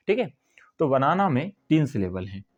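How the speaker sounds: ordinary talk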